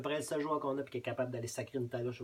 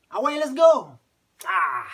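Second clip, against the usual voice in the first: loud, high yell at the game; shouted cheering; 13 semitones higher, 16 dB louder, slower than usual. Acted. Hockey fan urging the team on, shouting. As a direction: roar the team on, then let out a cry.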